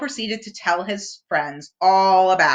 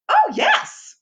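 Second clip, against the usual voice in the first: Rabbit chuckling; 4 dB louder than usual. A narrator's reading. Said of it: "Rabbit chuckled".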